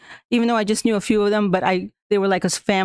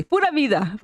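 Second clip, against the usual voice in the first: "Pura vida" called out high and bright; cheerful greeting; 6 semitones higher than usual. Retold or imitated. Greeting Mister Ward at school, a cheerful "Pura vida". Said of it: "I say".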